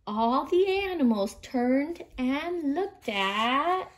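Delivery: ordinary delivery, nothing marked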